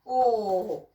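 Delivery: ordinary delivery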